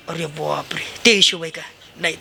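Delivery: low, dismayed groan of regret; dismayed dead man. Acted. Dead man caught out before Yama's clerk, low and dismayed. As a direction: sink low as the sins are counted.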